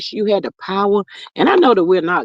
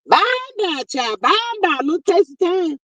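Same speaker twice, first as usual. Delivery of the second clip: sing-song goodbye; 10 semitones higher than usual. Real, not acted. Waving off a commenter.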